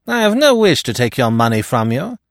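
high, loud refusal; affronted rival. Acted. Giraud answers Poirot's wager angrily.

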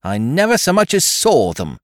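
high, loud indignant denial; heated protest. A narrator's reading. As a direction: insist hotly on innocence. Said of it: "she declared heatedly".

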